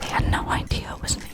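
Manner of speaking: voiceless whisper of her words; retold confession under the breath; whispered quote. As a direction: imitate her in a hushed whisper. Retold quote after laughing.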